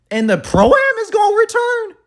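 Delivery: real amazed shout; excited shout. Real, not acted. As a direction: shout it with rising hype. Listing announcements in disbelief.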